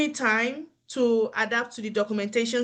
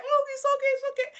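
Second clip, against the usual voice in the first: very high, airy brush-off of trouble; mock resigned; 14 semitones higher, much faster than usual. Spouse back home shrugging off a failing marriage, mock resigned. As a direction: wave the problem away, pitched right up. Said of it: "You're like".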